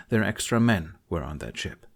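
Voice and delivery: usual speaking voice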